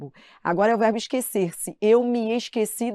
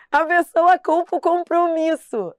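laughing; 8 semitones higher, 6 dB louder than usual. Real, not acted. Laughing at the excuse.

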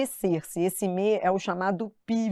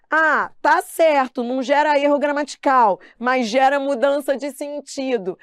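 imitating the test takers; 4 semitones higher, 5 dB louder than usual. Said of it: "a galera disse assim".

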